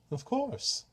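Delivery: high, light and quiet of course; breezy trickster. Acted. Klepnos to Esme, high and airy. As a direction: toss it off airily.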